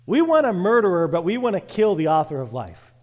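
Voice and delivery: loud, rising preacher cry; fiery preaching. Acted. Preacher rebuking the crowd from the pulpit, loudly. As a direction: call out the crowd's hypocrisy.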